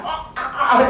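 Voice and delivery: hammed-up yelps of pain, pitched high; overreacting. Speaker fakes a hit leg in the queue, overreacting. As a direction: fake being hurt, overdo it. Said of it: "and then I overreact".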